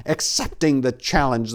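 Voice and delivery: ordinary delivery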